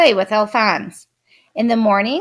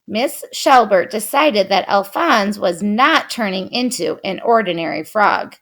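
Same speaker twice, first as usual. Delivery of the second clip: ordinary delivery, nothing marked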